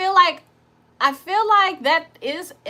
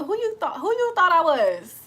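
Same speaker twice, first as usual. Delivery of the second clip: higher-pitched, lightly offended comeback; mock indignation; 5 semitones higher than usual. Baby mama to the man offering leftovers, mock indignant. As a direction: play at being affronted.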